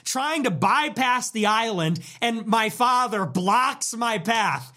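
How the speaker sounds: normal talking voice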